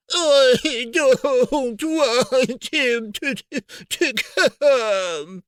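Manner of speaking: high, drawn-out fake sobbing; yelling through fake sobs. A narrator's reading. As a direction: whine broken by pretend sobs. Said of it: "Dudley yelled between huge pretend sobs".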